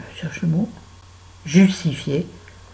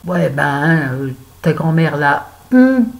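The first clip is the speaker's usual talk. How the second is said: loud, rough street-kid imitation; hammed-up racaille quoting grandma; mock thug voice; 7 dB louder, slower than usual. Son quoted by his mother, loud mock thug tone. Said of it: "Une phrase de racaille".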